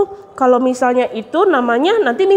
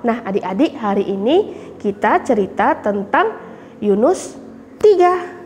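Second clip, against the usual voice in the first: ordinary talk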